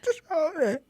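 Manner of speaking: high fake sob mixed with laughter; mock crying. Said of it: "Are you crying or are you?".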